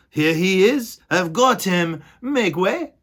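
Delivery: a loud, high call to make way; Furlow's voice. Acted. Furlow calling to the crowd of mice. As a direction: push through the crowd calling out. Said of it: "said Furlow".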